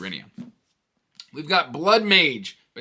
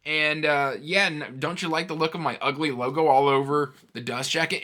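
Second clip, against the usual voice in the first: much higher, louder mock boast; cheeky logo mocking the reader; voicing a smug logo; 5 semitones higher, 6 dB louder than usual. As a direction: play the brash logo showing off. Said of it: "jumps out at you and goes".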